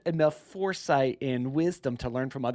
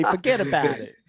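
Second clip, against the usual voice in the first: loud, higher mock New Yorker accent; 5 semitones higher, 6 dB louder than usual. Shown as a demonstration. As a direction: show off a big playful New York accent. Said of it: "in your best New Yorker accent".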